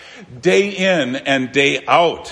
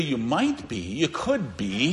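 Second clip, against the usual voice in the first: hedging; 4 semitones lower, 9 dB quieter than usual. Retold or imitated. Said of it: "He doesn't say".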